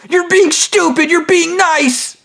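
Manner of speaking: high-pitched mocking squawk; mock scolding. Imitating Ganon objecting to kindness, mock scolding. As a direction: scold in a silly high voice, last word stretched.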